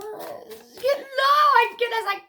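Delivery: high and loud, losing it with giddy laughter; cracking up, can barely get the words out; real overexcited squeal of delight. Real, not acted. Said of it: "I've gotta pull myself back".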